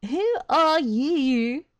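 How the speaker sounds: loud, high, drawn-out weird voice; weird character voice. Retold or imitated. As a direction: imitate a goofy cutscene voice, stretched out. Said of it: "it was such a great voice".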